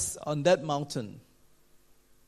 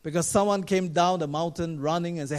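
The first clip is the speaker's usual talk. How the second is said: normal talking voice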